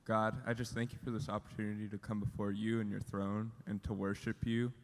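normal talking voice

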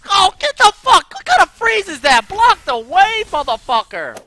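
full gamer rage, high and loud; furious yelling. Real, not acted. Gamer yelling at his teammate, furious and loud. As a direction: shout it at your useless teammate, high and loud.

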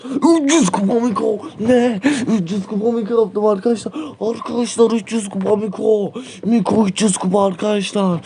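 high, thrilled shouting of the milestone; can't believe the win, pitch it up high; overjoyed excitement. Real, not acted. Reaching 300 trophies with Miko.

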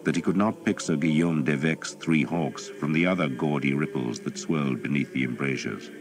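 ordinary delivery

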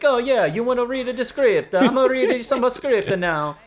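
high, loud bad Italian accent; terrible Italian accent. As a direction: ham up a hopelessly fake Italian accent. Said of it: "this is the worst Italian accent I've ever heard".

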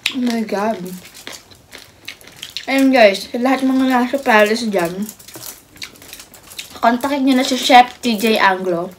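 chewing between words; eating while talking; mouth full, chewing. Real, not acted. Seen 1: Left woman talks to camera between bites, chewing pork throughout.

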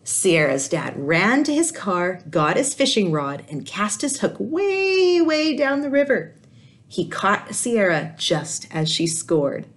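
put-on lively read-aloud voice for kids; animated children's story reading; storybook narration. A narrator's reading. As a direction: tell it like a picture book to a class. Teacher reads picture book aloud.

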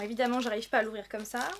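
ordinary delivery

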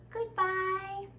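usual speaking voice

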